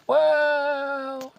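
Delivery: high, loud, long-held note of doubt; sceptical drawn-out well. Real, not acted. Reader disputes the book's claim, sceptical drawl. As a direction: stretch the word out in disbelief. Scoffs at the line just read.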